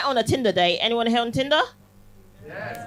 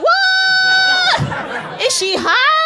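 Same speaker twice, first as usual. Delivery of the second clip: much higher, loud squeal of disbelief; mock shocked audience; 6 dB louder, much slower than usual. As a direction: squeal like a scandalised crowd member. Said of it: "looking at me like".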